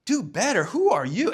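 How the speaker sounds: offended, throwing the words back; indignant. Retold or imitated. Waiter fuming over the note on the receipt, indignant. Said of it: "And he was pissed".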